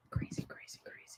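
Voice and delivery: breathy whisper, barely voiced; hushed crazy, crazy. Real, not acted. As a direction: whisper it to yourself in disbelief. Whispered aside after rant.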